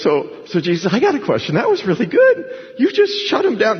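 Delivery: high-pitched mock-amazed questioner; eager impressed scribe. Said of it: "So he goes".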